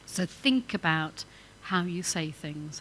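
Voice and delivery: ordinary talk, nothing marked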